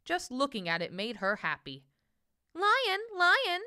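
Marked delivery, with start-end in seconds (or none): 2.5-3.6 high, light little bird voice calling out; a small bird calling lion, lion, high and light. A narrator's reading. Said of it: "she said".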